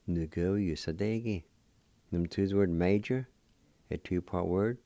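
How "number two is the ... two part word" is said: ordinary talk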